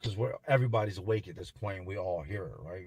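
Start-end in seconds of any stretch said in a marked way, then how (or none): none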